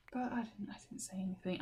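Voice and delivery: a soft, breathless whisper; whispered aside. Real, not acted. Confessing to viewers she stayed silent, whispered. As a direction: lean in and whisper it like a secret.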